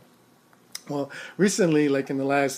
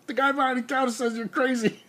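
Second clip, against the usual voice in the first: amused report; 9 semitones higher, 4 dB quieter than usual. Retold or imitated. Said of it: "and they say".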